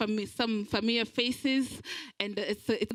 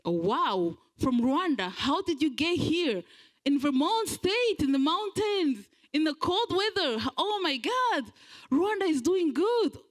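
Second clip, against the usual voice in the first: rising into a much higher, amazed exclamation; amazed American; 7 semitones higher than usual. Retold or imitated. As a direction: gush like an astonished American. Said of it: "I get like".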